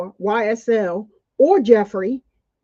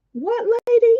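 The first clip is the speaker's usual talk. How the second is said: much higher pitched, incredulous what lady; outraged disbelief; 11 semitones higher than usual. Real, not acted. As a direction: jump way up in pure disbelief. Outraged at gang accusation.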